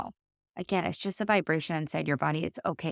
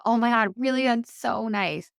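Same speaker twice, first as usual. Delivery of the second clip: higher, louder gush of delight; delighted friend; 7 semitones higher, 4 dB louder than usual. Retold or imitated. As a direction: imitate the friend's thrilled thanks. Friend's delighted reply voiced.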